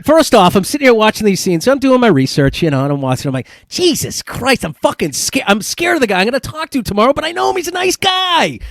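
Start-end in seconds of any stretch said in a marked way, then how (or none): 3.7-8.6 high, rising mock fright, a bit faster; mock scared. Host tells guest his scenes scared him, high mock fright. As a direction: playfully act spooked by the guy on screen. Podcaster retells watching scary scenes.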